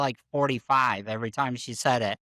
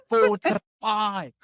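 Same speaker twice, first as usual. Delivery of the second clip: put-on slow, high "four to five" in her accent; drawn out like the doula; South African accent; 7 semitones higher, much slower than usual. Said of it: "She's like".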